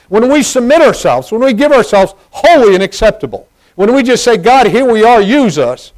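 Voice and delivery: high, loud pulpit preaching; full-force preaching. Real, not acted. Preacher urging the church to serve, preaching loudly. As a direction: drive it out to the whole congregation, high and loud.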